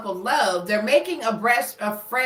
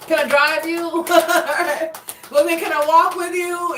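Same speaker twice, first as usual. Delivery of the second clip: lightly voiced eager pleading, a bit higher and louder, laughing through it; pushy pleading partner; 5 semitones higher, 4 dB louder than usual. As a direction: play the clingy partner begging to tag along, half-laughing. Pushy partner's offers, acted.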